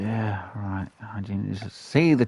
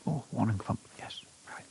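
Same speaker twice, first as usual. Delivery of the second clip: soft whispered aside; 9 dB quieter than usual. Real, not acted. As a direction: keep it a hush so nothing startles. Hunter whispering while stalking.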